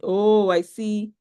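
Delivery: ordinary talk, nothing marked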